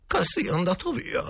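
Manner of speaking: high, hushed worried question; whispered question. Acted. Asking the cook if he has gone, high and anxious. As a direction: ask nervously, pitched well up.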